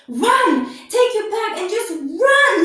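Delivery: high, loud retold yell to run; urgent shout. Driver drops her at Union Station, urgent shout. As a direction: urge someone to sprint, loud and high.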